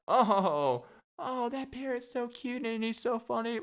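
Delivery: high-pitched mock gushing; gushing audience member. Audience adoring the parrot, high mock gushing. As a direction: coo in a high, put-on voice. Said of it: "And then you're like".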